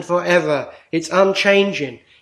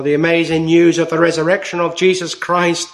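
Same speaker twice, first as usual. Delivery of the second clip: ordinary talk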